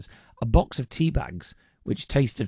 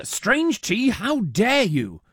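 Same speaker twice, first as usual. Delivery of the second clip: high, drawn-out mock outrage; hammed-up offended exclamation; mock indignation; 11 semitones higher, much slower than usual. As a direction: read it as playful, slow-burning affront. Reads out a listener's mock outrage.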